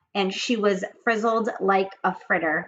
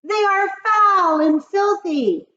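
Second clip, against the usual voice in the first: high loud eager witches; witch chorus; 10 dB louder, slower than usual. Acted. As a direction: agree like a cackling coven.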